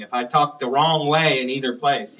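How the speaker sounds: normal talking voice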